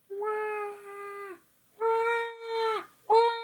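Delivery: put-on a distant little crying baby. Baby crying far away.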